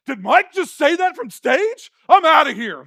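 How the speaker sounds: loud, high disbelief; mock scandalised. Churchgoer hearing the pastor swear from stage, acted loud shock. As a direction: play the appalled listener storming off. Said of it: "You'd be like".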